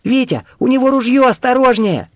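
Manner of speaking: high, loud warning cry; urgent, high-pitched female character voice; ringing girl's voice. A narrator's reading. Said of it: "заглушил звонкий голос Кати".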